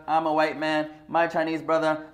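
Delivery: ordinary talk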